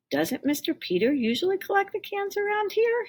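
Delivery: high, gently doubtful mother; mom's voice. A narrator's reading. Mom asking Tim about Mister Peter, high motherly voice. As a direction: question him like a wary mom.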